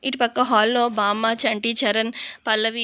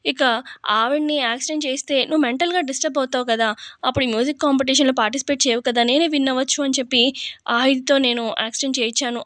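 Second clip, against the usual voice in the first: ordinary delivery, nothing marked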